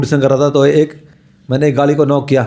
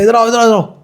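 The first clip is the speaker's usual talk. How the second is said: calling out; 8 semitones higher than usual. Retold or imitated. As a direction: call him over urgently. Woman calls the monk back.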